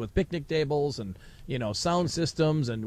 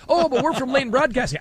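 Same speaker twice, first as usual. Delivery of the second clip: pleading protest; 9 semitones higher than usual. Retold or imitated. Host protests the eviction.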